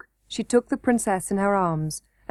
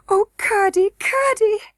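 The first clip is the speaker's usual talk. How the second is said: much higher, desperate call; pleading cry; 11 semitones higher, 4 dB louder than usual. A narrator's reading. Princess crying out after Curdie, high and desperate. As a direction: call him back in dismay.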